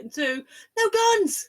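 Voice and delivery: deadpan build then a loud, slow payoff; punchline emphasis. Acted. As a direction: land the joke hard on no guns. Punchline of a joke.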